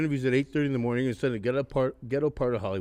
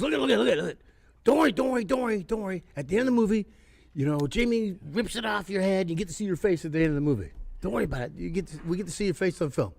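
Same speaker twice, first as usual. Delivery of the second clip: higher, hurried reassuring woman's voice; wardrobe lady impression; 7 semitones higher than usual. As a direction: soothe the actor in a high, rushed voice. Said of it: "she goes".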